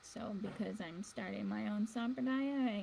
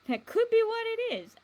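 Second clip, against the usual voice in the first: excited; 14 semitones higher, 11 dB louder than usual. Real, not acted.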